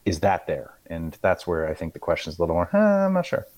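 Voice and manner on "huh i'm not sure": doubtful quote. Retold or imitated.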